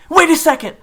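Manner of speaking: very high, loud scream. Acted. June screaming at David to stop, loud high scream. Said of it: "June screamed".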